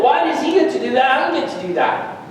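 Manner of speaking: put-on high, indignant complaint as the older brother; resentful. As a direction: protest the unfairness, drawing out the last words. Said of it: "Because he's resentful".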